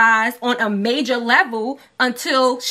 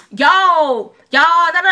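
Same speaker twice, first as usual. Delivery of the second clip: louder, higher mock Bronx rapper voice; 6 semitones higher, 9 dB louder, much slower than usual. Co-host tries a rapper's voice and gives up, mild mock impression. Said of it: "I can't even talk like [name]".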